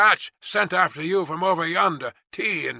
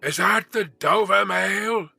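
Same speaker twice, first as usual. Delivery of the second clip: slow, raised calling voice; calling from afar; 6 semitones higher, 4 dB louder, slower than usual. A narrator's reading. Rider calling from the mist, slow hail. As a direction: hail them across a distance. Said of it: "a man's voice called from the mist".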